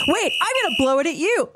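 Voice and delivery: high-pitched mock-excited threat; retold gleeful warning; playful threat. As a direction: tease with sudden high excitement. Acted playful threat with horn.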